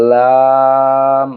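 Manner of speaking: লাম with the vowel held very long and level; drawn-out letter name. Shown as a demonstration. Teacher naming the Arabic letter for learners, লাম drawn out long. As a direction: stretch লাম slowly on an even tone. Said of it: "এর নাম হলো".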